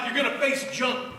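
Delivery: ordinary talk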